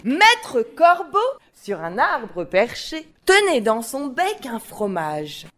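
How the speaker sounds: slow, animated storytelling with swooping pitch; expressive recitation. Shown as a demonstration. Teacher shows children how to hold classmates' attention, expressive storytelling. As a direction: tell it to grab attention, stretching the phrases. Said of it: "Alors que si je dis".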